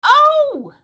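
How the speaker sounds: high, loud burst of surprise; a sudden delighted gasp of oh; surprised oh. Real, not acted. To Steve on hearing the server name, a high surprised oh.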